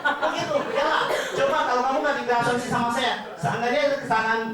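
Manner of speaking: high laughing voice. Real, not acted. Agent tells trainees the joke, laughing.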